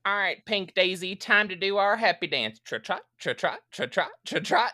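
high, singsong coaxing with bouncy trot sounds; playful horse-rider. Retold or imitated. Rider to his horse leaving the courthouse, gleeful mock coaxing. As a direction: tease with a prancing, high-pitched lilt.

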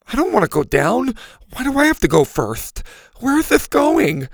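high, loud frightened whining; whiny panic. A narrator's reading. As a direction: cry out each question in dread. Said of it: "Mac groaned".

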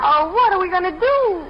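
high, fretful wail; anxious high-pitched lament; dismayed. Acted. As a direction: worry aloud, pitch climbing high. Wife reacts to uncle's trouble.

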